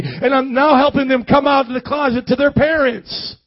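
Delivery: high, excited mock testimony; eager testimony. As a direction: imitate an over-eager believer testifying to church. Acted excited church testimony.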